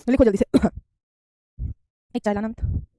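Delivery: usual speaking voice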